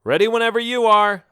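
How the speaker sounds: high, loud call; yelling. Acted. Boy tells Melvin they are ready, yelling. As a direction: holler it to the other side. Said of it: "I yelled".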